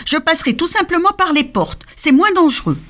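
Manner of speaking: renne aigu qui donne un conseil; voix du renne. A narrator's reading. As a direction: conseiller d'un ton malin. (le renne conseille le Père Noël)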